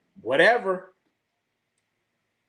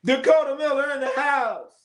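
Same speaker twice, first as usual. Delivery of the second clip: announcer hype; 11 semitones higher than usual. Pretend. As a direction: announce him like a hype man. Hyping arrival like announcer.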